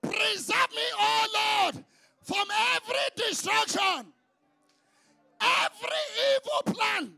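fervent shouted prayer with long held Oh Lords; a pastor leading the congregation in loud prayer; preaching prayer. Acted. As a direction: cry out each plea to God.

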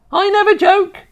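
very high, loud retort; indignant old grandma. Acted. Grandma denying to her grandson she jokes, indignant. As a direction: insist in a high, forceful grandma voice.

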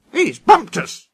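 high, indignant storybook scream; shrill-pitched squawk of complaint. Acted. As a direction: cry out in high-pitched outrage. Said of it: "screamed the coaches".

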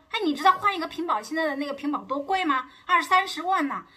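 high, loud mock outraged griping; husband complaining. Husband griping to wife about protector cost, mock outraged.

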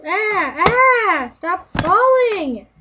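loud frustrated cry at falling CDs; annoyed yelling. Real, not acted.

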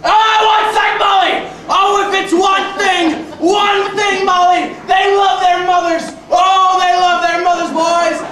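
loud, high comic rant; ranting shout. Real, not acted. Comic yelling at the crowd about Irish mothers, loud rant. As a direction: yell it at the whole room.

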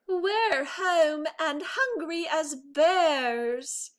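high, slow, hearty bear chorus voice; bears announcing. A narrator's reading. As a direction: announce it like hungry bears marching on stage. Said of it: "announced the three bears".

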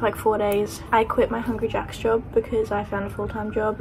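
ordinary delivery

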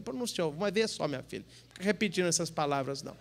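soft, low aside to the woman; hushed priestly reproof. Acted. Priest tells the woman not to repeat those words, hushed reproof. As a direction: drop right down, acted discreet reply.

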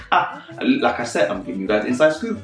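normal talking voice

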